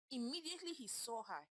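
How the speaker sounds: plain reading voice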